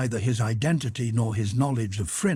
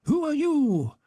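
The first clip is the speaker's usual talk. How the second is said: alarmed question. A narrator's reading. Gerasim confronts drunk servant.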